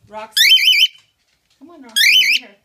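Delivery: loud call across the room; calling the dog. Real, not acted.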